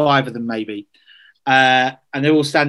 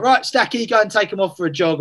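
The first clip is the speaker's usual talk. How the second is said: higher, brisk call to the player; manager's order; 6 semitones higher than usual. Retold or imitated. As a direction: send him off like a boss.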